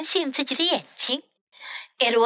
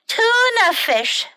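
high, slow and louder; dismayed Dexter; 10 semitones higher, 4 dB louder than usual. Acted. Dexter finding tuna again, high and slow. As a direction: wail it out in dismay.

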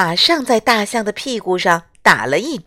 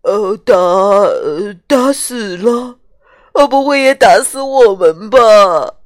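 terrified; 6 dB louder than usual. Acted. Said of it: "河马和豪猪吓傻了".

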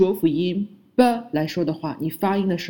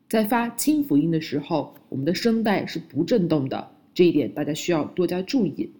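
usual speaking voice

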